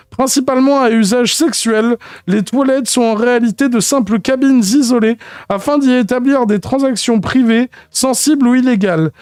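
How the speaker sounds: mock-formal lexicon reading; mock solemn reading. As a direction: read the entry like a grave encyclopedia. Reads lexicon entry in put-on voice.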